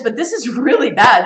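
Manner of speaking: Paul's rebuke as a loud shout; shocked outburst. Retold or imitated. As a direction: cry it out, appalled. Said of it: "He says".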